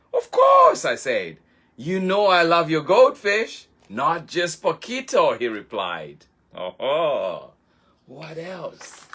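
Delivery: put-on children's librarian reading to little listeners, gently; storytime narration. A narrator's reading. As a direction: read it like a bedtime picture book. Reads picture book aloud.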